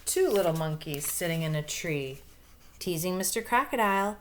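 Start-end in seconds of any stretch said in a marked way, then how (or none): none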